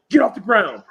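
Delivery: imitated instructor's shouted command; barked order. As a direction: bark it like a drill instructor. Voicing an instructor ordering a trainee.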